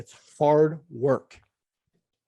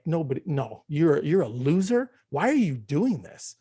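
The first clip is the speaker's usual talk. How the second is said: self-mocking inner voice; 3 semitones higher, slower than usual. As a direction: jeer at yourself for being childish. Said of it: "I was like".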